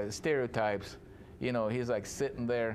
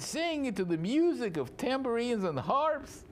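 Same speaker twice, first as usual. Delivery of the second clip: high, wounded plea, climbing higher; tearful pleading; 9 semitones higher than usual. Acted. A betrayed Laban reproaches Jacob over his daughters, tearful pleading. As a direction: act a hurt father, voice rising toward tears. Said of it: "You can just picture him crying".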